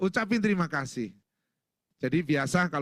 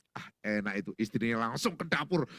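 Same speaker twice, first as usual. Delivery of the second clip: ordinary talk, nothing marked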